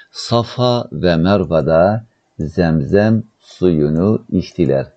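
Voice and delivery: very slow, stretched word endings; word by word, with pauses between; slow dictation. Shown as a demonstration. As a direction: dictate slowly to a class. Teacher dictates the translation.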